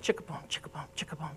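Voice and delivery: low, soft rhythmic machine chug; mimeograph machine imitation. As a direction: imitate an old copier chugging away, half whispered. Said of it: "I can still hear that mimeograph machine".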